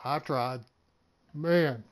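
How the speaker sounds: ordinary delivery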